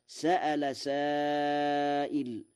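سأل سائل with the hamza, a clear glottal stop in سأل; سأل with hamza, chanted slowly; Hafs reading. Shown as a demonstration. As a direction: read سأل سائل slowly in Quranic recitation style. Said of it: "قرأ ورش".

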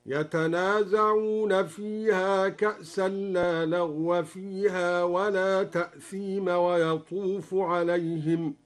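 slow recitation, "لغو" as laghwa and "تأثيم" as ta'thima; measured demo, fatha on the ends of "لغو" and "تأثيم"; fatha without tanween. Shown as a demonstration. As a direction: recite slowly, joining "تأثيم" to the next word with a short a. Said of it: "قرأ الدوري بفتح الواو والميم".

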